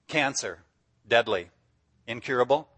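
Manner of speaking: usual speaking voice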